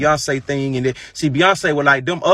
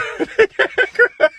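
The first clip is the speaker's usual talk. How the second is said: high, loud burst of laughter; laughing; 5 dB louder than usual. Real, not acted. Vlogger cracking up at own remark, high laugh.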